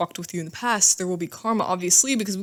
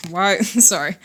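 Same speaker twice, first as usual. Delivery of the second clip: ordinary talk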